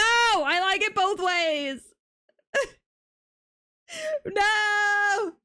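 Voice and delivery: high, loud laughing protest; cracking up while yelling no. Real, not acted. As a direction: shout it back at chat, half laughing. Arguing with chat, yelling back.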